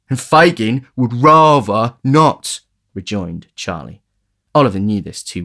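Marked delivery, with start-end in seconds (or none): 0.1-2.6 slow, loud knowing drawl; slow ominous retort. A narrator's reading. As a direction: lean on each word ominously. Charlie's slow ominous reply.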